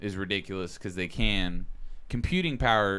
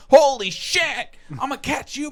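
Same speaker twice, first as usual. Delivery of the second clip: high, loud, slowed astonished yell; excited shout; 10 dB louder, slower than usual. Retold or imitated. Gamer acting out spotting a Charizard in town, astonished shout. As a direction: yell it like a wild Pokemon just appeared.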